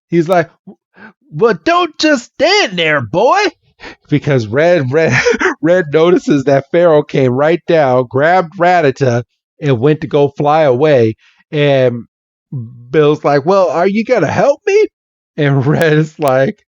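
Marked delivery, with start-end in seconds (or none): 1.3-3.4 high, urgent Southern scolding; hammed-up Southern exasperation; Bill. Bill being carried off by a bird, Southern accent. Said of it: "this Southern accent". 13.4-14.9 quick, higher Southern plea; hammed-up impatient Southern fella; Bill. Bill demanding help from Red, Southern accent. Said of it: "this Southern accent".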